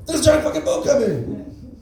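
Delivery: very high, drawn-out comic scream; mock panicked yell. Anthem singer spots boat heading for bridge, mock panicked yell. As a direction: yell a warning in hammed-up alarm. Anthem parody turns into alarm cry.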